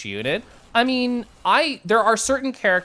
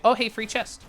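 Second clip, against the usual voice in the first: usual speaking voice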